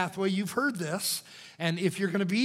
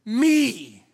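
loud, high single cry; shouted; 7 semitones higher, 11 dB louder than usual. Acted. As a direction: fling the word out in agony. Preacher's dramatic self-accusation.

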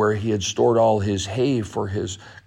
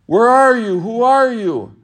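higher, louder call across the burning barn; lifted voice; 9 semitones higher, 6 dB louder than usual. Acted. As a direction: shout out to find the voice. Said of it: "Lumberjack lifted his voice".

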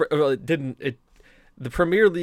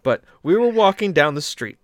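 normal talking voice

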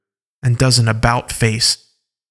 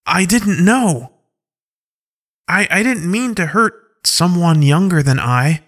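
higher, stammering apology; flustered young wolf; 5 semitones higher than usual. Acted. Skyla apologising for hurting someone younger, flustered. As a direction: stumble over the apology in dismay.

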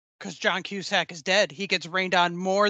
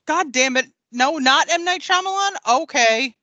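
louder, rising disbelief; mock exasperation; 6 semitones higher, 5 dB louder than usual. Acting out watching the movie critically, mock exasperation. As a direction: voice climbs higher into an incredulous question.

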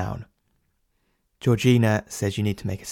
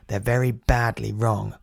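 usual speaking voice

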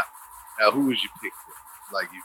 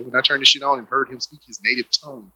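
ordinary delivery

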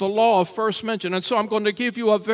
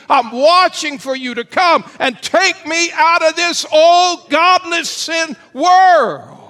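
building from firm to a loud, high shout; rising to a fervent shouted prayer; preaching at full force; 4 semitones higher, 6 dB louder than usual. Acted.

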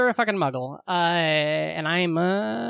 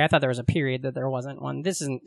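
ordinary talk

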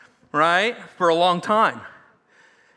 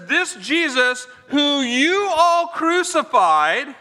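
slow and higher, proclaiming to a crowd; bold declaration; 6 semitones higher, 3 dB louder, much slower than usual. Retold or imitated. Peter proclaiming Jesus Lord to the Jerusalem crowd. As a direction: lay each word down like a verdict. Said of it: "Peter looks out at this crowd and he says".

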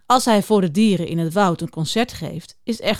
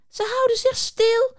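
high, indignant call; 12 semitones higher than usual. Acted. Juniper calls back at the beaver, high and indignant. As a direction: protest it out high. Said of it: "roept Juniper".

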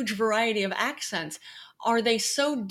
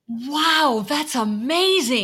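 louder, drawn-out astonishment; real amazement; 3 semitones higher, 5 dB louder, much slower than usual. Real, not acted. Host hearing how village accents differ, really amazed. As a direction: marvel slowly at what you heard.

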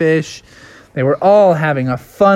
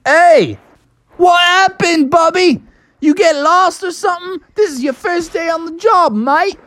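much higher Australian twang; Australian boss questioning his late driver. Acted. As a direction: scold him like an Aussie boss. Boss Dingo Dan scolds Bubby.

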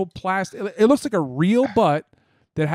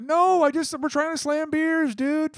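much higher, pleading mock voice; high mock protest; 10 semitones higher than usual. Friend imitates himself pleading over beers, high squeaky voice. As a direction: play the innocent drinking buddy, squeaky. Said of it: "You're like".

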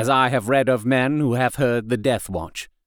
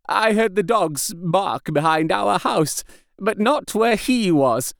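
dying man, urgent; 5 semitones higher than usual. A narrator's reading. Dying patient begging the doctor to hear him, frail and urgent. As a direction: press on as if time is running out. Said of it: "he said, hurriedly".

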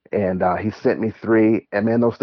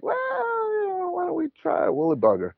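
hesitant deflecting; 5 dB quieter than usual. Retold or imitated. Shop guy putting off an eager beginner, hesitant and high. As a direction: imitate someone tactfully steering a beginner away. Acts out tyer gently dissuading him.

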